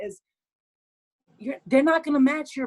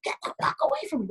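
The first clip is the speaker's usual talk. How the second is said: mock snap; 7 semitones higher, faster than usual. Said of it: "if you're like".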